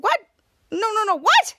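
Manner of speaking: high, loud, acted-out disbelief; disbelieving protest. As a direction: object in alarm, pitch jumping up. Ray protests officers abandoning kid.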